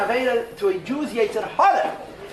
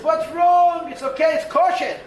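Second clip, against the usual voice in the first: higher and louder, coaxing tempter; Yetzer Hara tempting; 8 semitones higher, 6 dB louder than usual. Acted. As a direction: brush off every doubt like a seducer. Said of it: "The Yetzer Hara comes to you and says".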